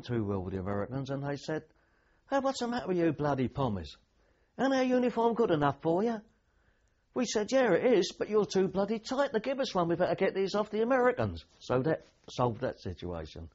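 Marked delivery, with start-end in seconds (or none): 2.3-6.2 mock-offended Aussie. Aussie soldiers jeering at the pommies in borrowed uniforms, high and indignant. 7.5-11.5 cheeky retort. Retold or imitated. Pommy soldier answering the jeering Aussies, high cocky retort.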